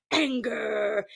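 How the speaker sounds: low, growled single word; mock anger. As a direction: growl it in pretend menace. Acting Wanda's anger.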